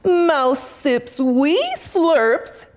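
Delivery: plain reading voice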